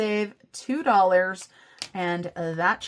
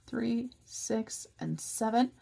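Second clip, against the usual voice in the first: whispering; 3 semitones higher, 6 dB quieter, much slower than usual. Real, not acted. Counting money under breath.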